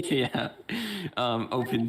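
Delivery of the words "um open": ordinary talk, nothing marked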